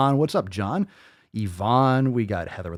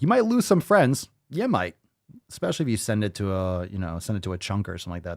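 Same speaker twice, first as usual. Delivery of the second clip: ordinary talk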